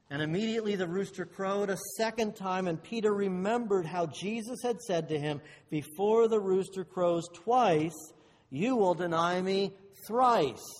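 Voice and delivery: loud scripture reading, slowing for the prophecy; emphatic scripture reading. Acted. Preacher reading Jesus' warning to Peter, emphatic scripture reading. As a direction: read the verse out forcefully, building to the end. Reads the Bible verse aloud.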